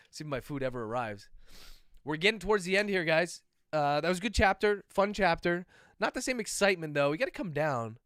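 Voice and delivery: ordinary talk, nothing marked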